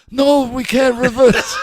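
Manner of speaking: loud, high, drawn-out punchline voice; slow, high and loud, as the patient spoke; doctor in a hammed-up high voice; high-pitched doctor. Doctor now has patient's high voice.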